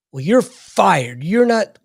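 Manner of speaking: hammed-up angry boss; mock angry firing. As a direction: imitate a chief firing someone, pitch climbing. Retold chief firing the speaker.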